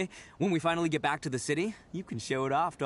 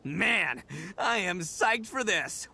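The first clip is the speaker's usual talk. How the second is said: usual speaking voice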